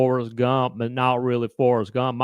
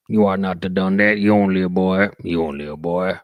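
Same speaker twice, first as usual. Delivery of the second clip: Sling Blade voice; 7 semitones lower than usual. Retold or imitated. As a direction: drop low into the Sling Blade character. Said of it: "Sling Blade is kinda like this".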